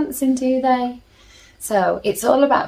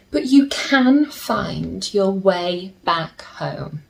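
gentle storybook reading with slow pauses; soothing storybook narration for little listeners; reading to children; 2 semitones higher, slower than usual. A narrator's reading. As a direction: read it like a bedtime picture book. Reads picture book to children.

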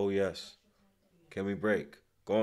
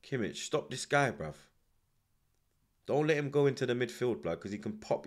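normal talking voice

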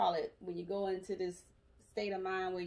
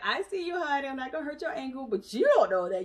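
reassuring nurse; 4 semitones higher, 7 dB louder than usual. Retold or imitated. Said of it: "the lady was like".

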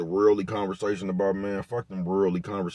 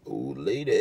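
lower, softer put-on voice for the nephew's line; lightly voiced nephew imitation; imitating nephew; 6 semitones lower, 6 dB quieter than usual. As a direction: mimic the kid's old lady quip, dropped low and soft. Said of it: "Hey, what you say, bro?".

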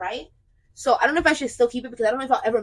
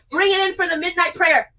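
mock preacher call; 5 semitones higher, 7 dB louder than usual. Mock preacher calling congregation.

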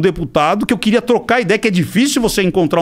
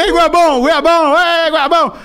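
mocking crowd taunt; 8 semitones higher than usual. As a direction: taunt him like a heckling crowd. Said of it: "E aí vem a galera dele". (provocando como a galera zombando, mais alto e agudo)

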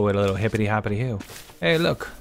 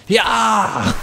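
high, loud screaming yell; angry goat yell; 10 semitones higher, 7 dB louder than usual. Acted. Telling the passerby the goat is mad, a loud high yell.

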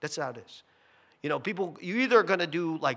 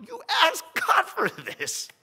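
exasperated retort; 13 semitones higher, 4 dB louder, slower than usual. Retold or imitated. Pastor's retort retold.

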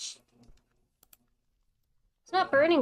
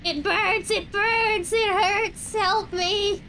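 high, loud agonized cries; burning scream; 9 semitones higher, 7 dB louder, slower than usual. Pretend. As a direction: scream in pain like you're on fire. Voicing a burning victim.

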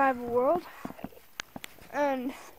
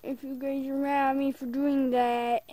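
ordinary talk

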